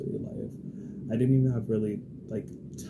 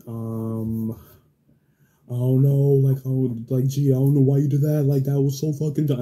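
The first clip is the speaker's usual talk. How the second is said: very loud, high mock outburst; imitated attitude; 4 semitones higher, 13 dB louder than usual. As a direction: mimic him popping off at full volume. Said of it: "that attitude is pissing me".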